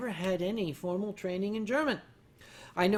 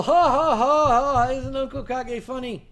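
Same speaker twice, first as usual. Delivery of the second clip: higher, louder imitated chuckle; mock laughing guy; 6 semitones higher, 11 dB louder than usual. A fan laughing at Uncle Kage's joke, mock laughter. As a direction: laugh along like an amused fan. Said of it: "And the guy laughed".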